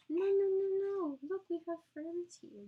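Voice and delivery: high, loud sing-song hushing. Real, not acted. Soothing a fussing baby.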